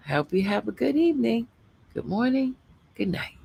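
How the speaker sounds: usual speaking voice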